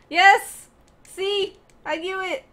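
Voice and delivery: high, loud triumphant cheer; a burst of "told you so" glee; excited yes. Real, not acted. Player spotting others on the bed, cheering.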